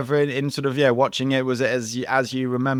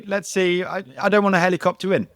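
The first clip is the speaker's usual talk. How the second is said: mock casual; 10 semitones higher, 3 dB louder than usual. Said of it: "They're like".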